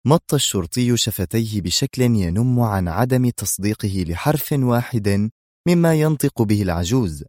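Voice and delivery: ordinary delivery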